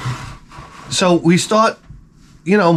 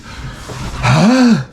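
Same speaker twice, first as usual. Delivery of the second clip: higher, hammed-up gasp of a stunned crowd; mock shocked gasp; 5 semitones higher than usual. Crowd hears the champion's answer, mock shocked gasp. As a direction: gasp as if scandalized, playfully. Imagined crowd reacting to winner.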